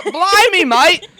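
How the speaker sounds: high, loud mock British accent; hammed-up British accent. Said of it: "He said".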